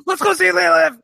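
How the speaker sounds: big gasp, then a high, loud shout; mock-excited kids. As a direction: shriek it like two thrilled kids. Acting out excited kids' reply.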